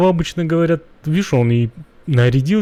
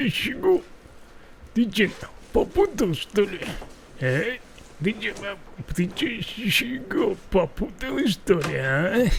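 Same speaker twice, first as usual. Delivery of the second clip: mock thug voice; 6 semitones higher, 5 dB quieter than usual. Acted thug taunt at character.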